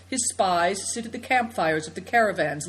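ordinary delivery

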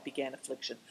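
usual speaking voice

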